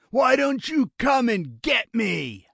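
slow, high-pitched yell; taunting shout. A narrator's reading. Gunman daring hidden enemies to attack, taunting shout. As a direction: dare them at the top of the voice. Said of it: "Lionel shouted".

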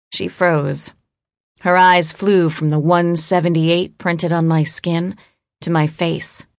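ordinary delivery, nothing marked

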